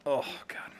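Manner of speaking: breathy dismayed whisper under the breath; whispered oh god. Real, not acted. Gamer whispers to himself after a miss, dismayed. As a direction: sigh it out as a whisper. Muttering after a bad shot.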